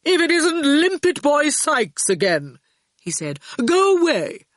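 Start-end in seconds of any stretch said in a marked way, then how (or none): 0.0-2.6 high, mocking voice of a lofty older boy; superior Torquil. A narrator's reading. Torquil teasing Howard from the altar steps, high and mocking. 3.6-4.4 high, mocking voice of a lofty older boy; superior Torquil. A narrator's reading. Torquil teasing Howard from the altar steps, high and mocking.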